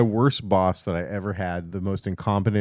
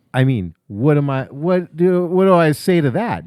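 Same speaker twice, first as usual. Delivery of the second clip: higher, louder incredulous question; mock exasperation; 5 semitones higher, 5 dB louder than usual. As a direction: sound baffled and cornered. Retold exasperated inner voice.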